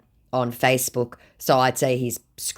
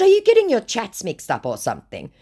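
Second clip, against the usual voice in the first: high-pitched acted questioning; mock suspicious; 10 semitones higher, 5 dB louder than usual. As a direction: challenge them in a raised, louder voice. Acted question, later read back plain.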